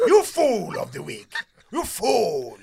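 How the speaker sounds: high, loud playful yell; mock shout. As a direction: yell it like a cartoon insult. Mock insulting a co-host.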